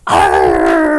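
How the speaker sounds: loud, long pirate roar; pirate "arrr". Pretend. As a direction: burst out with a drawn-out arrr. Pirate roar ends the song.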